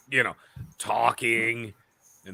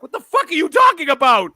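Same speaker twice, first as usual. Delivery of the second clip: higher, louder baffled outburst; loud high confused rant, slowing on the bad guy; exasperated outburst; 8 semitones higher, 6 dB louder than usual. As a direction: demand answers, fed up.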